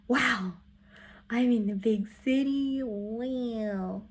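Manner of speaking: mildly wide-eyed, drawn-out wonder; retold awe. Vlogger gazing at the big city, mock-awed. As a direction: act lightly awestruck by the sight. Quoting own awe at the view.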